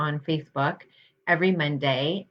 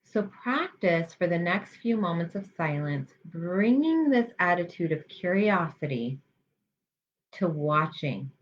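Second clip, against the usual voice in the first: guided meditation. Real, not acted. Health psychologist guiding listeners through mindfulness practice, calm guided meditation. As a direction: lead them gently, leaving space between phrases.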